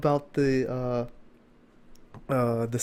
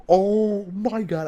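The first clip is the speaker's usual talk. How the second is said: stunned excitement; 6 semitones higher, 5 dB louder, much slower than usual. Retold or imitated.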